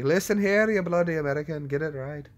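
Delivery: high, mock-scolding British accent. Imagined viewers yelling at an American, mock British accent. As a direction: put on a British accent and tell them off. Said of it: "starting to talk in a British accent".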